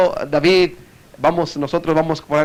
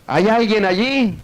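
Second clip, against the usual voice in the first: high, drawn-out call into a cave; acted shout; 5 semitones higher than usual. Man calling into a dark cave, mock shout. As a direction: call out slowly, pitched high. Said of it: "él gritó".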